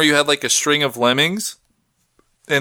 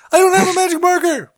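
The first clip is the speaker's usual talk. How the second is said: high, squealed panicked user; mock panic; 4 dB louder than usual. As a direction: squeal it in comic desperation. Panicked user answers the robot device.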